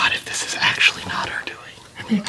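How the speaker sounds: unvoiced whisper to self. Real, not acted. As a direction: whisper it under breath. Muttering to self.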